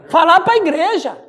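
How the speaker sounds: ordinary delivery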